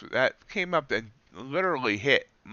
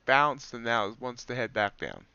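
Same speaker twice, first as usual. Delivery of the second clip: ordinary talk, nothing marked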